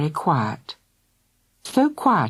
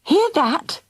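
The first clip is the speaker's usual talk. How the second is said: gasped; 11 semitones higher than usual. A narrator's reading. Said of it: "gasped Missus Thunder".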